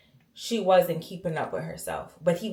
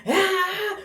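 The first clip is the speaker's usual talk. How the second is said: high-pitched imitated wail; mock bawling wail, pitched way up; bawling cry; 11 semitones higher than usual. Daughter imitating her mother's breakdown, bawling cry. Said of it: "bawling crying".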